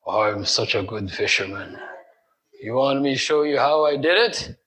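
a hint of a smug angler showing off; boastful fisherman. Preacher mimics a fisherman boasting to his friends, mock smug.

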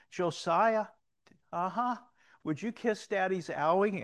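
lightly acted daddy asking his small boy; dad to little son. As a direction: call over a little kid in a lifted voice.

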